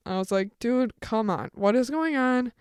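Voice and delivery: ordinary talk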